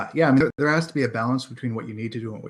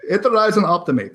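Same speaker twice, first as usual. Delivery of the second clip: "Iterate and Optimate" pitched higher and louder in a mock Eastern European accent; Borat voice; 8 semitones higher, 6 dB louder than usual. Host asks for a Borat voice, guest hams up a Borat impression. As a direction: ham up "Iterate and Optimate" like a clumsy foreign comic. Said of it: "Do that in a Borat voice?".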